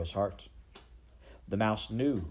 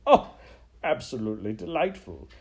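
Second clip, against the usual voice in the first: theatrical, gloating delight; Botticelli; 6 dB louder than usual. Acted. Rat relishes a mouse about to kill a rat, gloating delight. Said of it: "He slapped his tail on the floor in approval".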